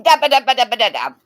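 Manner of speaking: a touch of mock frantic dabbing; octopus bingo lady dabbing. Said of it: "She's like an octopus going".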